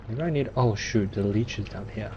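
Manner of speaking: usual speaking voice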